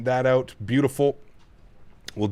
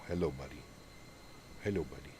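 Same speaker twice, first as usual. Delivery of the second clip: soft hello to the dog; 8 semitones lower, 16 dB quieter, faster than usual. Real, not acted.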